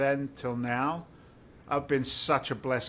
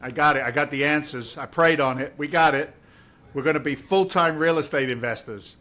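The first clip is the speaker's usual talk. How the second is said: louder, eager and bursting with news; excited; 7 dB louder than usual. Retold or imitated. Husband telling his wife the big plan, loud and excited. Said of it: "And I'm like".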